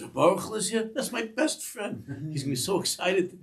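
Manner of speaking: very high, loud, excited retold voice; delighted outburst. Zushi hears his best friend is here, delighted outburst. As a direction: gush in a high-pitched imitation of overjoyed excitement.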